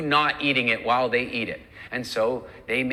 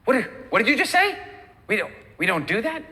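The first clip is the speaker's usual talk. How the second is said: loud, high-pitched incredulous questioning; shocked, squawked double-take; startled food devil; 9 semitones higher, 4 dB louder, faster than usual. Acted. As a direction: react in stunned disbelief, stammering. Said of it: "The food devil's gonna go".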